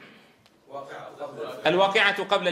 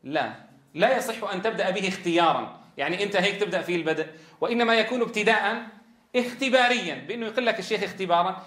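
normal talking voice

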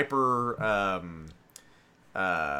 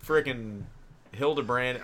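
ordinary talk, nothing marked

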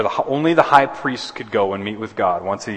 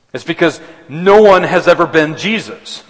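louder and higher, punching "no" with a falling close; preaching climax; 7 semitones higher, 9 dB louder than usual. Real, not acted. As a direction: proclaim it as the final answer.

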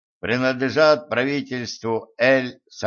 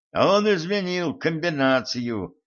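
higher, mildly alarmed exclamation; exclaiming; 6 semitones higher than usual. A narrator's reading. Dyer at the safe finds the combination changed, exclaiming. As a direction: cry out in understated despair. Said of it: "воскликнул Дайер".

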